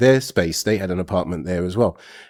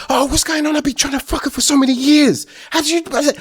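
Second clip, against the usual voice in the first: high whiny disbelieving friend; mock high whine of a jealous friend. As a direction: play the jealous mate, pitched way up.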